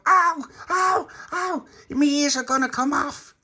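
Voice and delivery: high, loud wailing in pain; pained cry. Acted. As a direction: cry out as ears are pulled. Tony's ears stuck in wall.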